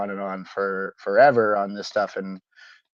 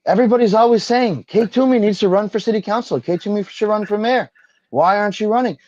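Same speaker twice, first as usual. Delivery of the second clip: much higher, loud, pleading mock-whine; mock upset libs; 11 semitones higher, 5 dB louder than usual. Host mocking liberals begging Toomey to run, high whine. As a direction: ham up the dismayed liberals begging her to run. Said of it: "they'd be like".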